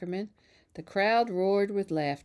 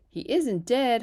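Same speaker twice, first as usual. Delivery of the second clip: hollered; 6 semitones higher, 5 dB louder than usual. A narrator's reading. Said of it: "hollered Zuckerman".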